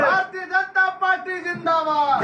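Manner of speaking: high, loud slogan shout leading the crowd; booming political rally speech. Real, not acted. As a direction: bellow the slogan out. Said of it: "सभी लोग जोर से बोलेंगे हाथ उठा करके".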